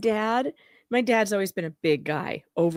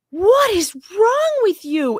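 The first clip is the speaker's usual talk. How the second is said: higher and slow, imitating outrage; retold shock; 10 semitones higher, 5 dB louder, slower than usual. Scolding dad for his rude remark, mock outraged. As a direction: stretch it out in mock disbelief. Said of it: "my mom and I both went".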